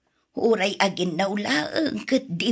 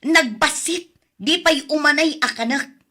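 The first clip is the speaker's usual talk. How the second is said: higher and louder exasperated scolding; angry scolding; 7 semitones higher, 5 dB louder than usual. Acted. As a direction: let the frustration burst out at the phone-addicted grandchild. Grandmother complains food too little. (makapungtot a panangungtot)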